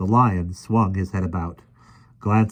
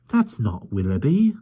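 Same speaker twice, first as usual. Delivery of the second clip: put-on boy Michael; 4 semitones higher than usual. A narrator's reading. Michael corrects keeper.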